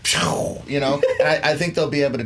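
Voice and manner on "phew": imitated jet whoosh, all breath; whoosh sound effect. Debater miming the armor flying off, whoosh sound effect. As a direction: blow out a rocket take-off noise.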